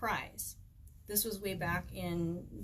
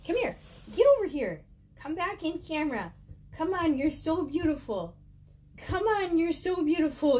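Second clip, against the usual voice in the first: much higher, louder sing-song pet voice; coaxing the dog; 9 semitones higher, 7 dB louder than usual. Real, not acted. Speaker coaxing her dog Bella into the camera, high cooing pet voice. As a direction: call the dog up sweetly and eagerly.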